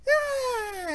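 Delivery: put-on very high, drawn-out child cheer; triumphant little kid. Kid celebrating over the fallen demon, triumphant. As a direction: squeal a small kid's victory cry. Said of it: "And he goes".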